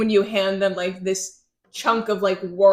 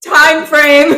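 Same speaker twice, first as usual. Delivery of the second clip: host voice; 5 semitones higher, 10 dB louder than usual. Real, not acted. Resetting podcast after laughing fit.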